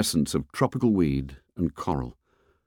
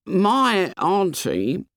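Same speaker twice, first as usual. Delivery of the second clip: put-on high, drawn-out boyish voice; fat boy's voice; 10 semitones higher, much slower than usual. A narrator's reading. Fat boy mentions his auntie, slow high boy voice. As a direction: stretch it out in a high schoolboy pitch.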